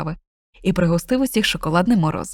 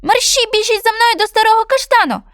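much higher, louder bird voice; chirpy bullfinch; 13 semitones higher, 5 dB louder than usual. Acted. Bullfinch urges cat and dog to follow, high bird voice. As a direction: chirp it urgently.

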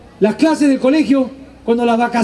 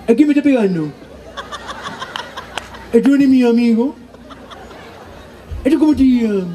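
confused, lightly laughing questions; lightly voiced brother confronting the bigger kids; dazed brother. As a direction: ask like a dazed kid after a slap.

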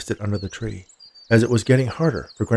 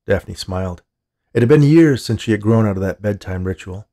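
usual speaking voice

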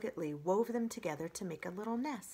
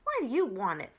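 higher, louder, sulky fox voice; scowling fox; 5 semitones higher, 7 dB louder, faster than usual. Acted. Fox scowling at the robot, high and sulky. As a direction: sulk like a thwarted fox. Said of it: "the fox scowled".

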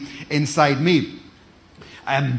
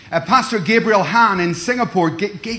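usual speaking voice

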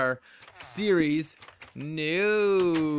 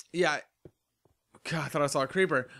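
ordinary delivery

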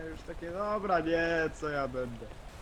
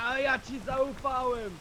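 mock betrayed cry; 7 semitones higher, slower than usual. Mock betrayal after the fall.

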